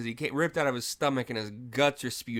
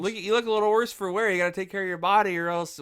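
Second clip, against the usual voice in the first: higher, louder cheesy villain quip; retold movie one-liner, pitched up; mimicked leprechaun; 8 semitones higher, 4 dB louder than usual. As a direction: taunt like a hammy leprechaun. Quoting leprechaun's cheesy lines.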